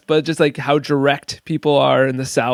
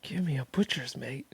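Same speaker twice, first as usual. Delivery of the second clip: quiet repeat; 3 semitones lower, 15 dB quieter than usual. Real, not acted.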